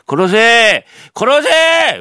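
high, loud, building yell; shouting kill me. Acted. Man begs thief to kill him. (声を張り上げて「殺せ」と叫ぶ)

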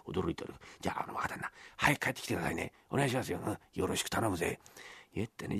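hushed, low plotting; very soft conspiratorial undertone; secretive. Acted. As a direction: murmur so the father can't hear. Whispered plotting behind father's back.

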